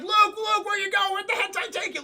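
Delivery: high, loud frantic shout after a lost friend; frantic calling out. Retold or imitated. Friends calling after Luke dragged underwater, frantic shout. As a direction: call out for Luke in a high panicked voice. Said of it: "they're like".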